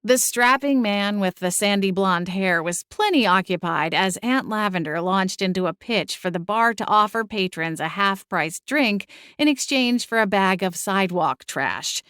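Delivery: ordinary delivery, nothing marked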